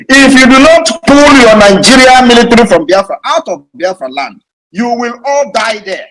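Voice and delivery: loud, high, forceful warning; shouted ultimatum. Real, not acted. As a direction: hurl the threat at full voice. Same threat repeated at shouting force.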